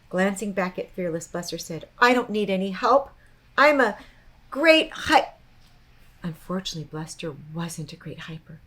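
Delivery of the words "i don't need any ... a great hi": boastful. A narrator's reading. Bluster boasting, then falls.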